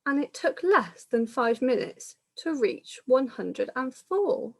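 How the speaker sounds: lilting rhyming storybook read-aloud; reading to children. Acted. Reader telling children the pandemic story, lilting storytelling. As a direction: carry the rhyme along for little listeners.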